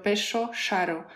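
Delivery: "pecho, charo" with a hushed SH for the double R; perro and jarro said as PESHO, SHARO. Shown as a demonstration. Giving examples of the Santiago del Estero accent, RR as SH. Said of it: "la R suena como sh".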